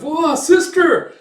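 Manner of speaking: very high, loud and slow, sobbing the word sister; wailing child. Acted. Boy crying over his executed sister, loud wail. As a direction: cry it out like a grieving little boy.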